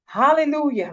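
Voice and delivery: a burst of real praise; sudden joyful Hallelujah; excited. Real, not acted. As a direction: caught up in the moment. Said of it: "Look, I got excited just then".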